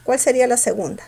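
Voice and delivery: ordinary delivery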